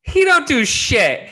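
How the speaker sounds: high, slow mock accusation; teasing jab. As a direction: rib him playfully. Teasing co-host.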